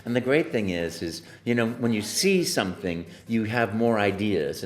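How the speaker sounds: ordinary delivery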